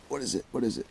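very soft, quick questioning; hushed suspense. Real, not acted. Angler peering down the hole at a hooked fish, hushed. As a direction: ask it under your breath.

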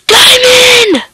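high gasping shout of joy; excited shout. Real, not acted. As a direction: yell it like you struck gold. Spots diamond ore, gasps.

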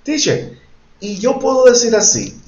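high-pitched student putting a question to teacher; imagined student asking. Acted. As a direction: pipe up as a pupil with a doubt, voice lifted high. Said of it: "me pongo en el lugar del otro".

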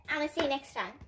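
plain reading voice

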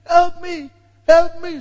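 high, pleading cry for help from a pit. A narrator's reading. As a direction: beg desperately, stretching the first plea slowly. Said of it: "somebody crying in the pit saying".

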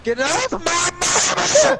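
high, drawn-out playful holler; laughing, hammed-up outrage; mock-outraged yell. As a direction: stretch it into a mock-protective shout. Yelling during chaotic game.

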